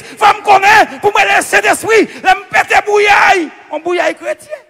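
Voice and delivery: high, shouted sermon cadence; full-force preaching. Real, not acted. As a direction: build a ringing, repeated pulpit refrain.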